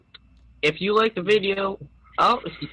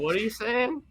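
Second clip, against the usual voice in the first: normal talking voice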